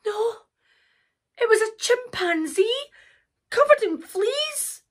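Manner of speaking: high-pitched storyteller's dismay; dismayed reveal. A narrator's reading. Narrator revealing the flea-covered chimpanzee, dismayed. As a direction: lift the voice high for the surprise.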